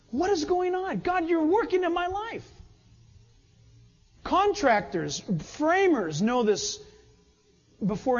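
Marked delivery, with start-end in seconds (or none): 0.1-2.5 high-pitched astonished wonder; amazed disbelief. Acted. Contractor to God after not cussing, amazed disbelief.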